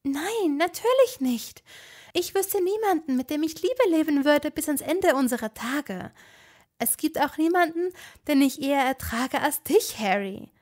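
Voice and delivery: high sincere Hermine. A narrator's reading. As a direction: declare lifelong love. Hermine voiced.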